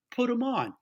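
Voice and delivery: high, lightly imitated boarding-school boss; Fritz. Boss asks to speak to the kid, mock upper-crust. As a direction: give a lofty order in a mock Thurston Howell voice. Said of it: "Fritz talked like Thurston Howell the third".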